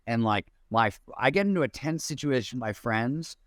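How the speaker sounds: ordinary talk, nothing marked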